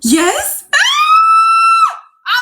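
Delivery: high yes into a piercing squeal; thrilled squeal. Acted. Woman hearing he'll take her shopping, ecstatic squeal.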